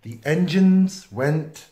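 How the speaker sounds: usual speaking voice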